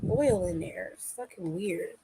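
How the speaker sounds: soft, distracted aside; muttered aside. Real, not acted. An aside while checking the oily phone case. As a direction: talk softly to yourself about the phone.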